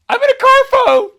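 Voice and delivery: put-on shrill cracking kid voice shouting the news; thrilled kid squeal. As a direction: squeak it out like a kid bursting with pride. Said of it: "my voice even cracked as it went higher".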